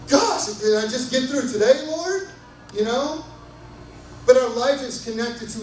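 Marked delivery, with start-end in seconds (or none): 0.1-2.3 mock desperate plea. Retold overwhelmed plea to God.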